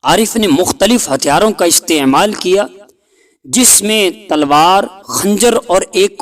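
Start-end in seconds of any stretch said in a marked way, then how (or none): none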